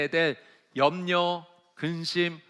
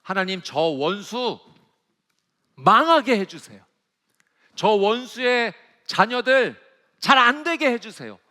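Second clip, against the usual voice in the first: bitter plea; 4 semitones higher, 7 dB louder than usual. Retold or imitated. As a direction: beg God hard to bring the enemy down. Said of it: "심지어 어떤 표현까지 나오냐면".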